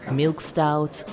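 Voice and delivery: usual speaking voice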